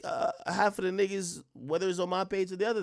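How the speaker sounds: ordinary delivery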